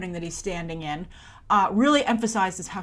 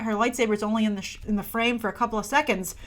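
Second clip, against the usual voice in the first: usual speaking voice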